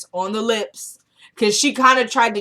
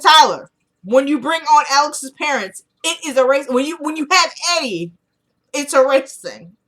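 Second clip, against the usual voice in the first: higher, louder, arguing the point; heated insistence; 6 semitones higher, 5 dB louder than usual. Retold or imitated. To Tyler about the script, a raised, insistent argument. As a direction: push back hard at Tyler, voice raised.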